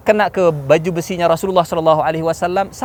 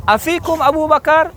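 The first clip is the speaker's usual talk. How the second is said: high, rising questioning call; 12 semitones higher, 3 dB louder than usual. Acted. Abu Sufyan to Muslims on the mountain, high challenging call. Commander calls up to hidden enemy.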